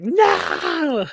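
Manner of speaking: very high, sharp shout; mock cry of no. Vlogger voicing furious Bianca's high no. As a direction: yelp it out in outrage. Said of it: "who's all like".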